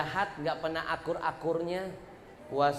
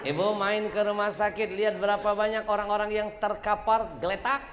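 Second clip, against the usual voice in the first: fiery preaching; 6 semitones higher than usual. Acted. Preacher rousing the women's study group, fiery. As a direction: drive the point home to the mothers.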